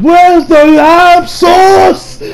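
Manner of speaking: high-pitched mock chef scream; Gordon Ramsay. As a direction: scream it slowly, stretching every word. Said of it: "screaming his head off, screaming".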